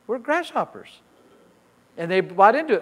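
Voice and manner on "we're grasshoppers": timid spies. Retold or imitated. Voicing fearful spies.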